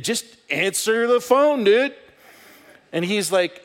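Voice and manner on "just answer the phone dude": slow, loud, high exasperated scolding; angry retort. Retold or imitated. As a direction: let him have it, drawn out. Retold angry scolding of landlord.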